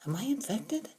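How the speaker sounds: hushed inner worry; thought aloud. A narrator's reading. Girl secretly fearing she is infected, hushed and soft. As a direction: whisper the doubt to yourself. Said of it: "I thought".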